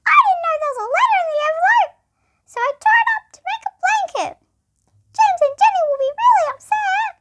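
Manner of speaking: high, fast mouse voice; high squeaky worried mouse. Acted. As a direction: squeak it out fretting. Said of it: "squeaked Mouse".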